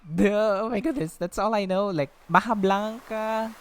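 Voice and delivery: loud, high, gushing; excited. Real, not acted. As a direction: rave about it at full volume. Excited rave about local sweets.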